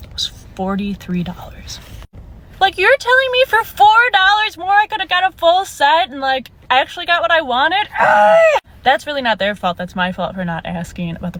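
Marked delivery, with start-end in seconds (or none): 2.6-7.9 high, louder outraged question; exasperated disbelief. Real, not acted. To viewers about the manicure price, high exasperated disbelief. 8.0-8.6 high loud exasperated shriek; frustrated scream. Real, not acted. Over paying forty-three dollars, loud frustrated shriek.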